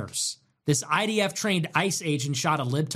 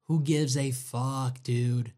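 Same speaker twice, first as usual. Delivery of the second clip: ordinary talk, nothing marked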